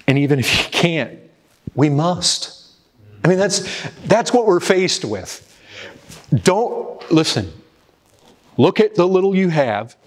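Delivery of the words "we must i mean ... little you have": rising, pleading high voice; preaching at full force; fervent appeal. Real, not acted. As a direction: urge them on, climbing in pitch.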